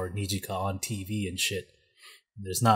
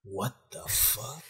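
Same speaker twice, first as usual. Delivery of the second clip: softer and slow, a stunned murmur; quiet slow disbelief; 3 semitones higher, 10 dB quieter, slower than usual. Retold or imitated. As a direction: let it slip out quietly, dragging. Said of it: "Ishimori's like".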